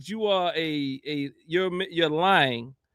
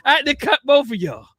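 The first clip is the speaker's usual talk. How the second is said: laughing; 3 semitones higher, 6 dB louder than usual. Real, not acted.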